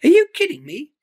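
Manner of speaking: high, loud disbelieving bird voice; incredulous Iris. Acted. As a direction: scoff at the very idea.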